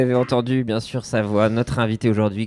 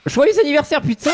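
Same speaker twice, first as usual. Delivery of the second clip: high, excited birthday cheer; excited shout. Real, not acted. Birthday greeting to the guest.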